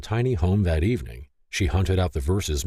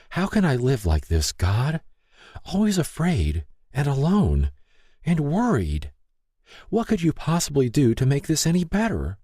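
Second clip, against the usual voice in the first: put-on higher, pleading young woman's voice; a hint of hurt and fear; Lay's anguished prayer; 9 semitones higher than usual. Lay prays in despair.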